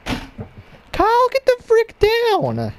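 very high, slow frantic shout at Kyle; shouting at Kyle on the computer desk; shrieking yell. Real, not acted. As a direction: scream to get him off the desk. Yelling at someone on his desk.

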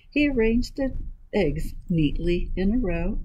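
ordinary delivery, nothing marked

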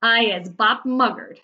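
loud, slow cry without much rise; a half-hearted screech, drawn out; mock screech. A narrator's reading. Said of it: "screeched the butcher boy".

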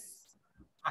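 অ্যাফ whispered slowly on its own; slow and soft. Shown as a demonstration. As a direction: breathe out অ্যাফ slowly, barely voiced. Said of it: "আস্তে আস্তে, slow".

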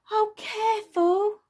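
high, loud, drawn-out careful; high-pitched child protest; alarmed child warning. Acted. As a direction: warn crossly as a small child. Bing warns the toddler.

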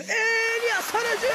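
long drawn-out high call of a name; excited commentator call. Real, not acted. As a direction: stretch the name out like a big chance. Goal chance, commentator call.

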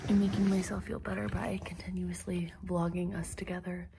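breathy whispered aside; hushed whisper beside the dog. Real, not acted. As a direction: whisper so the dog stays calm.

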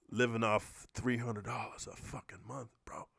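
breathy whisper, the budget told as a secret; whispered aside. Real, not acted. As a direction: whisper it like a confession. Whispered aside about living cheaply.

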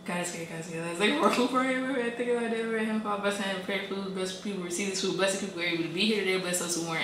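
quiet, hushed mealtime prayer. Real, not acted. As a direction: mumble a quick grace. Saying grace over food.

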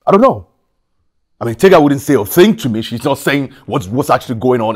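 loud, high, frustrated outburst; exasperated. Acted. Husband tells brother nobody at home explains, exasperated. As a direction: vent helplessly at full voice.

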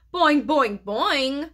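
bouncy, loud twang of boing. A narrator's reading. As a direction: twang it like a frog's strings. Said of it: "Og twanged".

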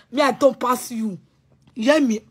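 ordinary delivery

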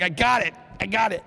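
mock insistence. Men insisting they cope.

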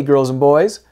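plain reading voice

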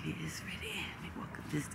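very soft whispered commentary; barely audible whispering; hushed whisper. Real, not acted. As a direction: keep it down for the golfer. Hushed as golfer gets ready.

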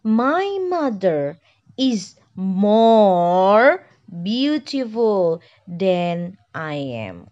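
teacher reading the example sentence slowly; slow model sentence. Shown as a demonstration. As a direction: read the example slowly so students can follow. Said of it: "So, my sentence become".

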